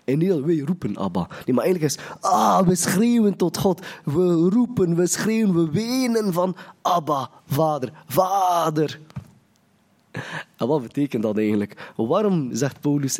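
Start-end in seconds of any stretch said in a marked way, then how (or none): 2.2-9.0 higher, drawn-out pleading cry; half-shouted, fervent appeal to the Father; crying out to God. Shown as a demonstration. Preacher showing how believers scream and weep to God, crying out. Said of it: "maar schreeuwen, maar ook huilen".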